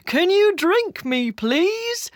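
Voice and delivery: high, excited talking-tea voice; smiling shout. A narrator's reading. Smiling tea asking to be drunk, shouting in a high voice. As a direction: call out high, rising on please. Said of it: "smiling and shouting".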